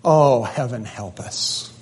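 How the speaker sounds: soft whispered sigh of despair; a whispered plea of despair. Retold or imitated. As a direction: breathe it out hopelessly. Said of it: "like we would say".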